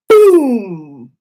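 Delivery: loud, sudden boom; explosive boom. Retold or imitated. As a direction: blast the word out. Water kefir jar exploding.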